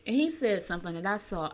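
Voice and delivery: ordinary talk, nothing marked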